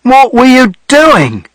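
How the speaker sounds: high, slow, loud demand; man demanding answers after the rescue, slow, high and loud; exasperated. Acted. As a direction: drag the question out in disbelief. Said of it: "he said".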